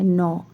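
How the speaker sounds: नौ as "nau", not "nyor"; correct pronunciation. Shown as a demonstration. Teacher modelling the ending of entrepreneur. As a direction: say नौ short and soft. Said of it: "इसको हम ना नौ पढ़ना है".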